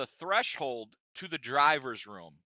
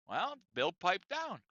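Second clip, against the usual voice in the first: normal talking voice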